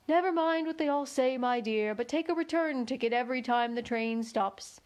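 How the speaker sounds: plain reading voice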